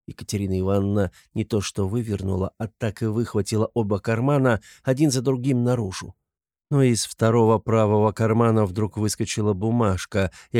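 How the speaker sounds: usual speaking voice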